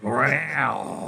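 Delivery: gruff Snake growling the word. Shown as a demonstration. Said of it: "have you do the Ocelot growl in Snake's voice".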